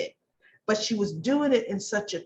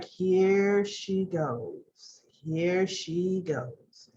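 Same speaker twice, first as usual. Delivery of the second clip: slow, quiet here-we-go; very slow, hushed knowing sigh of a line; knowing dread; 4 semitones lower, 5 dB quieter, much slower than usual. Retold or imitated. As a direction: drag it out softly, seeing trouble coming. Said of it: "And I was like".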